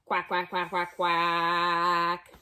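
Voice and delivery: low, playful duck quacking; duck quacks. Acted. Ducks answering Pete, low playful quacks. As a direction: quack like the ducks coming back. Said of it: "and they say".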